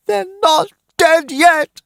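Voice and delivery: high, loud scream of denial; a frantic mother screaming. Acted. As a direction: scream it, refusing to believe. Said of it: "screamed Missus Dawson".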